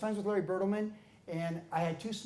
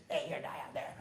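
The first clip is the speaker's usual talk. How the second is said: imitating Roy; 4 semitones lower, 7 dB quieter, faster than usual. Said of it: "Roy was like this".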